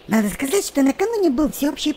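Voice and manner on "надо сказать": usual speaking voice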